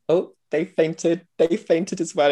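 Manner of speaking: high-pitched mock astonishment; retold surprise. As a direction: act out spotting it, high and wide-eyed. Acting out spotting fainters in audience.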